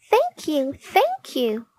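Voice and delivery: high, light tiny voice gushing thanks; a tiny fairy thanking her rescuer, high; grateful fairy. Acted. Rescued fairy thanks Jessie, high and eager. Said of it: "cried the fairy".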